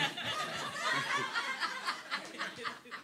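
a high, airy giggle; embarrassed laugh. Real, not acted. Struggling with the slides before the congregation, breathy embarrassed giggle. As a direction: laugh at his own fumbling.

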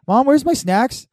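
put-on high whiny little kid voice. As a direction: ask it like a needy kid. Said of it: "everyone's talking".

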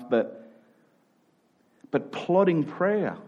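normal talking voice

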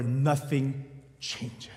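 a heavy, quiet sigh of a line; slow hushed gloom. Acted. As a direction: let it sink, soft and slow. Bleak point of the sermon.

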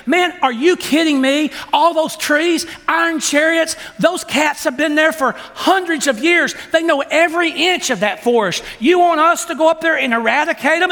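the tribe gasping at Joshua, are you kidding; tribe protesting. Acted.

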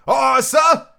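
high, loud sailor's answer; cried reply. Acted. Cook answering the squire from the passage, crying out. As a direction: call back smartly to a superior. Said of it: "cried the cook in passage".

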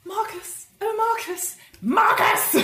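high, grating, calling a name; grating shrill calling. Retold or imitated. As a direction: build from a high call to a loud, high shriek. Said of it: "In your head, it sounds like".

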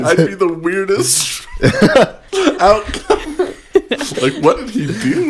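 laughing. Real, not acted. Laughing at the joke about dad.

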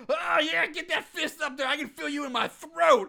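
high, loud, hammed-up arousal; mock ecstasy. Acting out the film character for co-host, mock ecstasy. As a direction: exaggerate the excitement, pitch way up.

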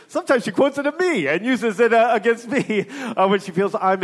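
rueful comic aside with laughter; amused laughing speech. Real, not acted. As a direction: tell it on yourself, laughing. Joking about wife quoting him.

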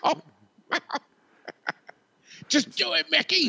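high, pleading yell at a partner; frantic movie impression. Player to Mickey at Russian roulette, frantic high plea. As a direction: urge them on in a high acted cry.

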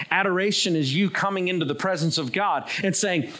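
ordinary talk, nothing marked

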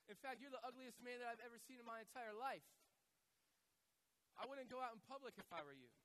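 high-pitched, cheeky parrot voice; insulting parrot. Acted. Parrot insulting a customer, high mock-rude delivery.

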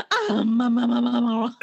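high, loud, over-the-top babbling; hammed-up frenzy over a baby; mock baby gushing. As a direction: gush like you could eat it up.

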